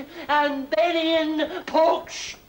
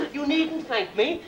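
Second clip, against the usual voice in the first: ordinary talk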